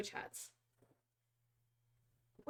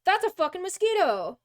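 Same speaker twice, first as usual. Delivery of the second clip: loud alarmed yell, higher; startled shout; 8 semitones higher, 7 dB louder than usual. Real, not acted. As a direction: shout in shock.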